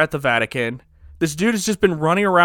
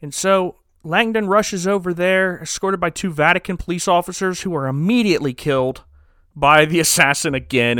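ordinary talk, nothing marked

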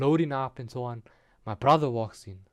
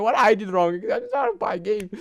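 put-on crying child; 7 semitones higher, 3 dB louder than usual. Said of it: "I'm like".

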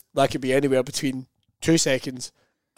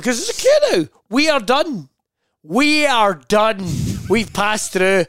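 high, emphatic panicked protest; mock desperation; 6 semitones higher, 4 dB louder, slower than usual. Shopper holding up queue awaiting wife, mock desperation. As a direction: hammer each word in comic despair. Acted panic at finished checkout.

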